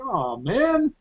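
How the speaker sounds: high, soft letdown whine; mock disappointment. Guards told not to shoot her, hammed-up disappointment. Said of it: "And they're like".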